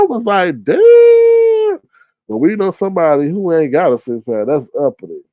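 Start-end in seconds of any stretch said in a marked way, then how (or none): 0.6-1.8 high, loud drawn-out exclamation; much higher, louder stretched damn; shocked. Real, not acted. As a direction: let it ring out in mock shock. Reacts to a friend's pictures.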